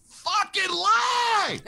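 very high, slow drawn-out shriek; shrill mental scream of outrage; inner voice screaming. Retold or imitated. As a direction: let the voice in your head scream it. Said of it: "I had that one voice going".

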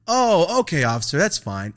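high, loud opening "oh", then easing down; mock compliance. Student looks at the officer and gives in, mock compliance. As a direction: act agreeable with exaggerated emphasis.

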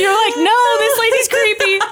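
high, loud laughing protest; real laughing. Real, not acted. Host jokes about fleeing in Mario Kart, laughing hard. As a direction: squeal it out through laughter. Cracking up at own joke.

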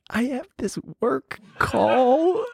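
high, very slow, strained-sounding excuse; drawn-out excuse. Retold or imitated. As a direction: stretch it out high, squirming out of a kiss. Awkward excuse to dodge a kiss.

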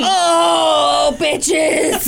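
high shocked outcry; shocked shout. Real, not acted. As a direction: yelp it in disbelief. Shocked reaction to news.

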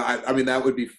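ordinary talk

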